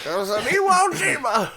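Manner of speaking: cartoon grandpa remembering the war; old man voice. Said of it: "my old man voice is just defaulting to, uh, Pop from Rugrats".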